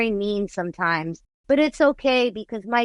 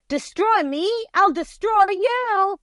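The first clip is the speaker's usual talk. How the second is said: quoted song lyric; 12 semitones higher, slower than usual. Retold or imitated. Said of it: "It had, like, a question mark in there".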